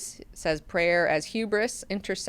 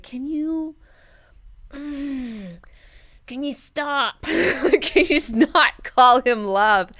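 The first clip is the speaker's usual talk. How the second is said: exasperated plea; 5 semitones higher, 4 dB louder, much slower than usual. Retold or imitated. Mineo exasperated at Hui.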